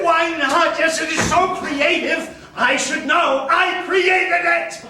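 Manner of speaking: shrill, loud self-defence; indignant shouting. Retold or imitated. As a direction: defend the work in a righteous burst. Defending his creativity.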